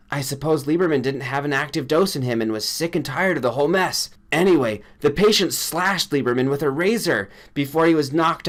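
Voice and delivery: a shaken friend reporting a killing; upset friend Granger. A narrator's reading. Granger voiced throughout.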